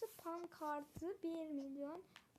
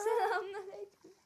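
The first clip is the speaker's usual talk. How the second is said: laughing greeting; 4 semitones higher, 11 dB louder than usual. Real, not acted. Laughing greeting, cry heard.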